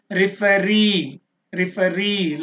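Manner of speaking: referee with a long, stressed final ree; last-syllable stress. Shown as a demonstration. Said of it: "last one". (referee లో చివరి ree పై ఒత్తిడి)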